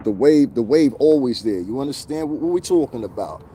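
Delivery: ordinary delivery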